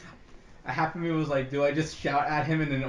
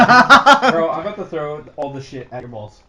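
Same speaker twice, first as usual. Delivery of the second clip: laughing; 13 dB louder than usual. Real, not acted. Laughing threat.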